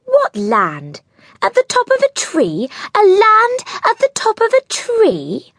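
rising to a high, incredulous squeak; puzzled. A narrator's reading.

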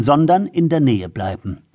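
ordinary delivery

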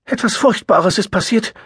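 high, pained and hurried; anguished. A narrator's reading. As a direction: blurt it out in distress. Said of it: "klangen gequält".

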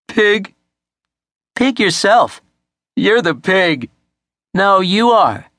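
high-pitched sibling squabble; bickering children. A narrator's reading. Brothers calling each other pig, childish bickering.

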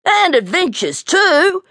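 high, thrilled little bear; childlike thrill of adventure; excited. A narrator's reading. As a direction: burst out eagerly, pitch leaping up. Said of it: "Blinky replied excitedly".

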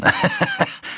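hohes, kurzes Schurkenkichern; Kichern. Acted. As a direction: kicher wie ein zufriedener Psychopath. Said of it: "Er kicherte". (hohes, gehässiges Kichern)

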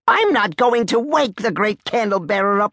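high, worked-up protest; indignant refusal. Acted. Major refusing to wake the candle bearer, high and indignant. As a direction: refuse in high-pitched outrage.

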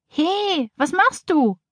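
high, indignant storybook exclamation; startled protest. A narrator's reading. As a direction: pitch up, protest in startled alarm. Girl protests as goat nibbles. (erschrockener, hoher Protestruf)

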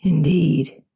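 low, husky murmur; deep husky voice. A narrator's reading. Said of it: "in the deep, husky voice she loved".

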